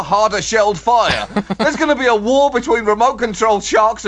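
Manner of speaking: hammed-up terrified excitement, far above normal pitch; mock panic. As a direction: shout it like a monster-movie warning. Mock panic over killer turtles.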